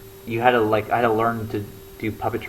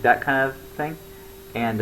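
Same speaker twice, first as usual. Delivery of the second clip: normal talking voice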